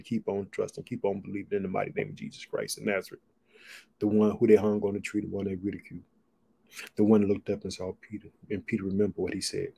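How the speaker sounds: low, soft, earnest prayer; closing prayer. Acted. Preacher closing the stream in prayer, low and earnest. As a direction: pray quietly over the viewers.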